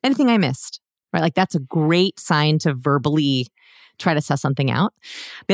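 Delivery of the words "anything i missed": checking in. Retold or imitated. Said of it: "It's a good time to be like".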